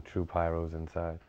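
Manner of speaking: ordinary delivery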